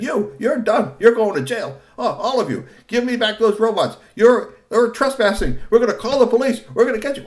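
high, loud threatening rant; raging threats. A narrator's reading. Kusumono threatening everyone, furious. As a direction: build into a lather. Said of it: "working himself up into a lather".

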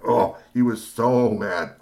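ordinary delivery, nothing marked

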